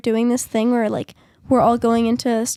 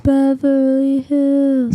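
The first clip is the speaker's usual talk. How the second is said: slow, louder dreamy drawl; dreamy echo; 2 semitones higher, 5 dB louder, much slower than usual. Pretend. Echoes it, drawn out.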